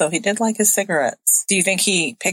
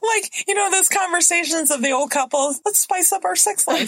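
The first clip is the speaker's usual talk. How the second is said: laughing; 8 semitones higher than usual. Real, not acted.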